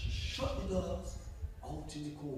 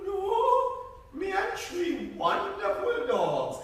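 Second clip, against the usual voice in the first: higher, slow bragging; boastful girl; 8 dB louder than usual. Acted. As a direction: show off the dogs. Buddy boasts.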